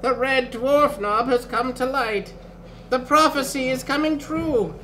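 put-on high-pitched prophetic old man; old sorcerer. A narrator's reading. Old sorcerer reveals the prophecy, high elderly voice. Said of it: "muttered the old sorcerer".

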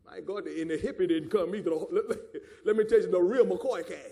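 ordinary talk, nothing marked

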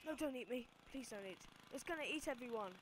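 loud mock pleading; hammed-up terror of being eaten; playful loud begging; mock panic. Pleading with monster harvester.

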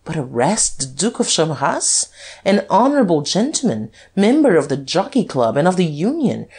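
high, ringing, incredulous questions; haughty defiance. A narrator's reading. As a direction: reel off your titles as a dare. Duke haughtily dares detective to arrest him.

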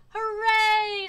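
loud, high cheer. A narrator's reading. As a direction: let out a big drawn-out hooray. Mayor cheers.

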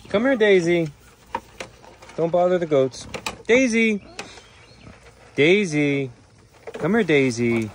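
high, loud animal call. Real, not acted. Keeper calling Daisy the pig away from goats, calling out. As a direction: summon a straying animal.

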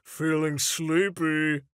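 slow drowsy reading. Acted. Reading own tweet aloud.

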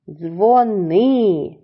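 "Дзвони" with a long stressed -ни; stress on second syllable. Shown as a demonstration. Teacher showing children the stress, long second syllable. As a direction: stretch NY in "Дзвони": dzvo-NYY. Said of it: "другому складі".

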